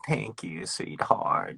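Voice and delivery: soft, breathy granny-style sweetheart; a lightly voiced grandma saying thanks; grandma version. Shown as a demonstration. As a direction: coo it like a sweet old grandma. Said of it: "not like a grandma version".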